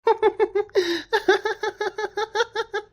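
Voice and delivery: high giggling cackle; mocking laugh. Daughter-in-law laughing at her quarrelling in-laws, high giggle. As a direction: keep laughing at them, high and teasing.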